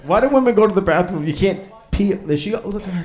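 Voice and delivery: normal talking voice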